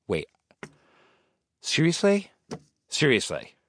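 high rising offended seriously; offended disbelief. Acted. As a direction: take it as a personal insult. Insulted by line about him.

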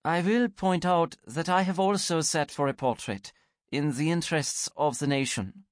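unbothered and serene. Acted. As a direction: Rebecca serenely answering Anne. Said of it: "very serenely".

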